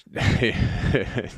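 real amused laugh mid-rant; short burst of laughter. Real, not acted. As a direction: laugh at your own story.

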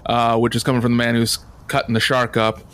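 normal talking voice

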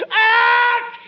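a high, loud explosive sneeze; comic sneeze that shakes the room. Acted. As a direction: blast out one huge sneeze. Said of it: "Did you hear [name] sneeze".

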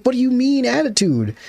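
high, incredulous question. Retold or imitated. Kid to parent, incredulous. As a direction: scoff at the word attitude. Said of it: "'Cause I'm like".

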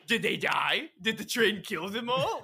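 high and loud, incredulous; shocked disbelief. Retold or imitated. Player watching the game cut off, in high disbelief. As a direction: squeal it like you can't believe the ending. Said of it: "I'm like".

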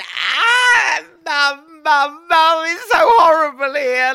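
high, loud wailing sob for mum; hammed-up homesick cry down the phone. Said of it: "like this".

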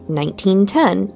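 ordinary delivery

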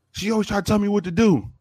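put-on high-pitched sulky teenage boy; imitated whiny teen. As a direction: whine it like a grumpy sixteen-year-old. Voicing the sixteen-year-old boy.

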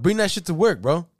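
high, loud imitated urging; insistent demand. Brother wants tapes brought to work, imitated loud insistence.

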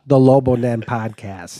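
slowed right down for clarity; deliberately slow. Shown as a demonstration. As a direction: say it slowly, word by word. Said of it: "Let me slow down".

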